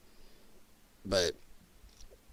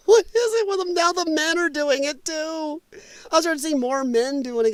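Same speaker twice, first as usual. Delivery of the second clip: high, incredulous mocking; 11 semitones higher than usual. Real, not acted. Host mocking lefties with nose rings, high incredulous squeak. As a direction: squeak it out in disbelief.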